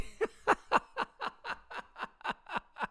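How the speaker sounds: airy squeal of laughter; high breathy laugh. Real, not acted. Streamer cracking up at chat, airy high laugh. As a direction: let a high voiceless laugh out.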